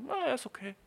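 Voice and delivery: put-on high-pitched girl, mildly approving; gnädiges Mädchen. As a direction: concede it in a high voice. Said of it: "ein Mädchen denkt so". (hohe, gnädige Mädchenstimme)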